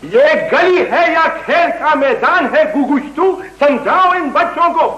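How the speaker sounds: high-pitched, indignant rebuke; irate scolding. Acted. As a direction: tell off the neighbourhood kids. Scolds children playing in the lane. (ऊँचे सुर में चिढ़कर डाँटना)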